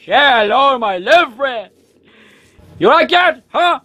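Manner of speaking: mock movie gangster line. Firing a big weapon, movie quote.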